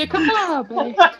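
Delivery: mock catchphrase. Another cohost hams catchphrase.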